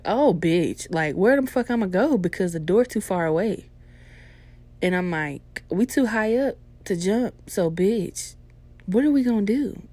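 loud, high hammed-up panic; mock panic. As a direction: freak out in your own head, loud. Panicking about ghost.

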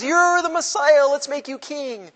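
high, loud crowd acclaim; excited crowd cry. Retold or imitated. Crowd wanting to crown Jesus, high excited shout. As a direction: hail him like an eager crowd. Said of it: "Everybody goes".

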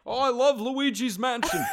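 loud, high-pitched politician impression; Bernie Sanders. Podcaster endorses the game, loud mock-senator voice. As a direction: holler it like an excitable senator. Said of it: "work on our Bernie impression".

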